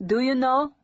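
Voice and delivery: charming Dutch waiter asks, lilting; a hint of Dutch accent. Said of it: "he asked in a delicious accent".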